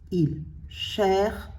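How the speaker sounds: "cherche" as "cher", pause, "che"; teacher's dictation, "cherche" with its final "che" set apart; split into syllables. Shown as a demonstration. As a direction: sound out "cherche" in two separate beats. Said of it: "je suis obligée de faire la syllabe".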